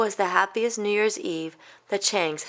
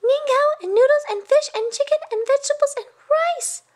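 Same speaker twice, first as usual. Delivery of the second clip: much higher, louder breathless list of treats; excited cry; 5 dB louder than usual. Acted. Little Da naming the whole feast, high excited cry. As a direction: rattle off every dish in glee. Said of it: "cried Da".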